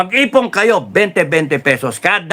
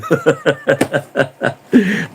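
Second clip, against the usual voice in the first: laugh; 2 semitones lower than usual. Real, not acted. Laughs after mock orchestra.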